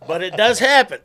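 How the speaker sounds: high, loud line through laughter; laughing retort. Retold or imitated. Dad answers daughter about poor country folk, laughing loudly. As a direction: laugh your way through the comeback. Laughing at his own reply.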